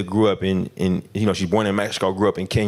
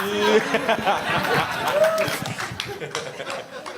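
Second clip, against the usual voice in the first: laughing; 13 semitones higher, faster than usual. Real, not acted. Laughing at tech glitch.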